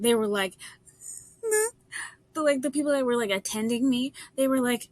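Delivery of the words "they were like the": usual speaking voice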